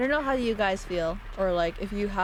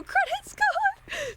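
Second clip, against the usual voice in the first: squeak; much slower than usual. Acted. Punchline with a squeaky jump.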